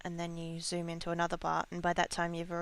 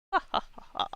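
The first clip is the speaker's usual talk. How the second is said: loud breathy mock villain cackle; evil laugh; 6 dB louder, much faster than usual. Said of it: "why I'm evil laughing".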